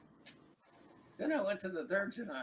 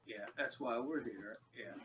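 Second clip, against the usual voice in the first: usual speaking voice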